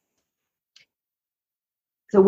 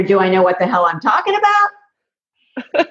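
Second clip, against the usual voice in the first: doubtful listener. Retold or imitated. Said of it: "so you wonder".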